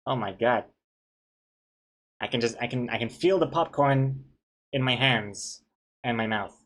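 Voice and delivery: ordinary talk, nothing marked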